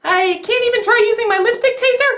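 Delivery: high-pitched put-on woman's voice; indignant heroine. Lucy objects to her captor, in high indignant mock voice.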